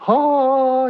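high, drawn-out mock swoon; moved moviegoer. Imitating someone leaving a love story at the movies, high swooning "oh".

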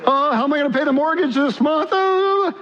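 a hammed-up anxious whine; mock worry. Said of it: "get worked up over".

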